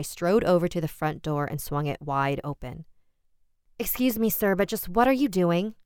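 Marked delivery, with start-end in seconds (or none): 3.8-5.7 sharp, demanding question, higher. A narrator's reading. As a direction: confront the stranger crisply. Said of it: "I demanded crisply".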